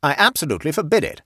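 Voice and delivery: fast, loud, high angry prohibition; thundering. A narrator's reading. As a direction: forbid it with a colonel's thunder. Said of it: "thundered the Colonel".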